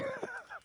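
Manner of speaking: short high giggle; a quick squeal of a laugh; high squeaky laugh. Real, not acted. Laughs after punchline.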